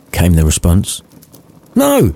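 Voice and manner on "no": higher, snappy refusal; irritated Scrooge. A narrator's reading. Scrooge refusing Cratchit the day off, irritated. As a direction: snap the refusal.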